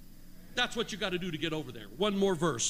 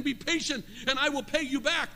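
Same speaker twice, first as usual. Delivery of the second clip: higher-pitched preached plea; begging; 6 semitones higher than usual. A narrator's reading. Fellow servant on his knees, begging. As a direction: plead for more time. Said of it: "begged him".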